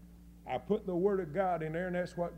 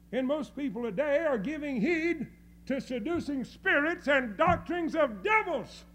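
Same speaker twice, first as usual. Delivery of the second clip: raised, urgent pulpit warning; forceful preaching; 6 semitones higher, 5 dB louder than usual. Real, not acted. Preacher warning of seducing spirits, forceful preaching. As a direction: ring it out as a warning.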